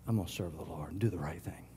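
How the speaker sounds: whisper. Acted. Whispered decision.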